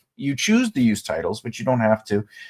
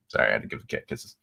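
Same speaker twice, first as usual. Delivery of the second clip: fast hushed whisper; quick whispered sorry; whispered aside; 7 dB quieter, much faster than usual. Real, not acted. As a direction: breathe the apology out quickly. Aside after kissing the cat.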